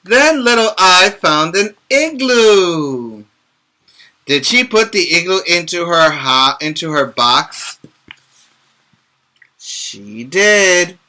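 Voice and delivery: lively storybook read-aloud; picture book voice for young listeners; reading to children. Acted. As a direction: read it brightly to little kids.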